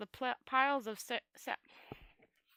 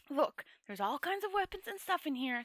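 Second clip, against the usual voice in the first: nervous wizard; 6 semitones higher than usual. A narrator's reading. Rincewind's voice, scheming.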